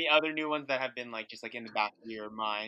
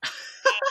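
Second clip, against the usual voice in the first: high laugh; 9 dB louder than usual. Real, not acted. Laughs at the wine drunk joke.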